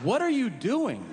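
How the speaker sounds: high-pitched mock outrage; exasperated challenge. Comic scolds the shusher, exasperated.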